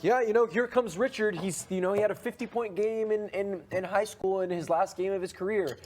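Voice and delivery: high, bright play-by-play caller; sports announcer voice. Retold or imitated. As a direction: hype up a player like a broadcaster. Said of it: "I'm like".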